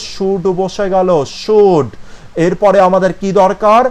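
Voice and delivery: ordinary talk, nothing marked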